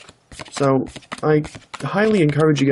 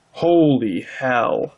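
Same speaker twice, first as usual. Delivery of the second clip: awed; 2 semitones lower, much slower than usual. Real, not acted. Pulls the card he wanted.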